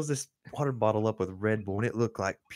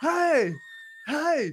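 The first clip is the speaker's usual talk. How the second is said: high, loud mock shout; imitated protesting holler; calling out; 6 dB louder than usual. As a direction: yell to get someone's attention. Captain keeps calling out.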